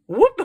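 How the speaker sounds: high, loud sudden whoop; startled whoop. Retold or imitated. Mum imitating the noise that startled the cat, high whoop. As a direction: yelp like a jumped cat. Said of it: "As in".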